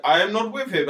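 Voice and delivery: a lightly voiced guy in a fake moustache denying everything; mock denial. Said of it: "and it's like".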